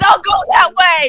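high, loud panicked yell; shouting. Real, not acted. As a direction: yell a warning across the room. Yelling warning during game chase.

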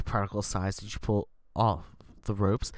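usual speaking voice

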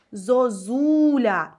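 зозуля drawn out very slowly, the stress on the middle зУ; slow stress demo. Shown as a demonstration. Teacher slowly showing students the stress in зозуля. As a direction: stretch зозуля slowly syllable by syllable. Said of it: "Ми чітко чуємо другу У".

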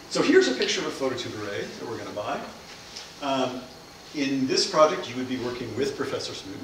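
ordinary talk, nothing marked

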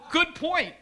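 loud, high, laughing concession; amused concession. Retold or imitated. Speaker retells conceding to her friend, amused and loud.

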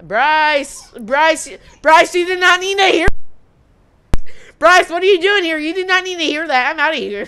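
panicked shouting at the brother who overheard; embarrassed yell. Real, not acted. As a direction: yell at him to get out. Embarrassed yelling at stepbrother.

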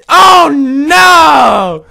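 long, loud, high wail; wailing dismay. Real, not acted. Gamer so close to winning, wailing in dismay. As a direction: howl at the near miss. Fails at last moment.

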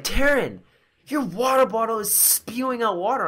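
high-pitched mimicked warning; friend's alarmed call. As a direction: imitate a friend urgently calling out. Voices friend alerting her.

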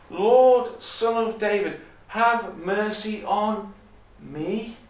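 high, slow appeal, loud at first; crying out. Acted. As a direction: call out "Lord", then draw out the plea. Re-voicing the woman's cry for mercy.

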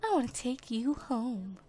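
mock tender whisper to the horse; soft cooing. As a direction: coax the horse softly. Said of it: "She's, like, whispering to it".

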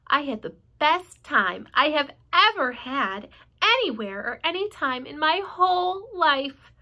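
put-on high delighted child voice; delighted girl. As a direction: gush about the best day. Fern delighted.

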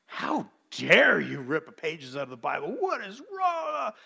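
a hint of indignant shouting; retold outrage. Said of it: "screaming at him".

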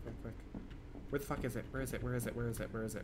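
ordinary talk

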